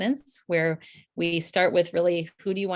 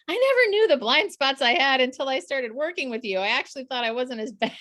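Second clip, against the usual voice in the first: higher, louder imitated client revelation; amazed client; 7 semitones higher, 4 dB louder than usual. Coach quotes clients on blind spots, amazed. Quoting clients' later reaction.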